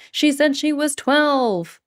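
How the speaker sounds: high, slow put-on voice; mimicking the video. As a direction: parrot the video line in a put-on voice. Imitating the viral video quote.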